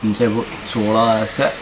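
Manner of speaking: usual speaking voice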